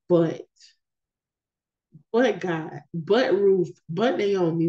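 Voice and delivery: but punched each time; each "but" stressed before God, Ruth and Naomi. Shown as a demonstration. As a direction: hit every but before the name. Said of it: "I kept putting emphasis on the word "but".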